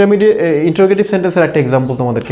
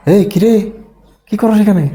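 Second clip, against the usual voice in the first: higher-pitched challenge, falling at the end; changed, challenging tone; 5 semitones higher than usual. Acted. Catching a friend outside a bar, challenging question. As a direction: call the friend out, suspicion in the question. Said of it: "কিন্তু tone কি করেছে?".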